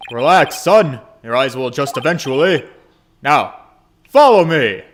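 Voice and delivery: big, loud booming father voice; boisterous dad rallying his boy; hearty dad. Acted. Father cheerfully pushing son into a cave trip, booming loud.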